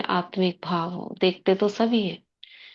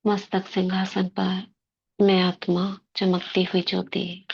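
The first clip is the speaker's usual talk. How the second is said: guided meditation. Acted. Sister guiding the class into soul-consciousness before murli, meditative.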